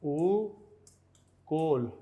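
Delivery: उकोल very slow, long oo, pause, kol; syllable by syllable. Shown as a demonstration. Teacher writes out the word for injection, उकोल in two slow syllables. Spelling out word while writing.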